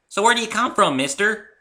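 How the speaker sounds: put-on high, loud excited child voice; a small filly bursting with curiosity; eager little filly.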